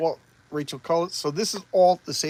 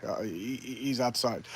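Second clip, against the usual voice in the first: ordinary talk, nothing marked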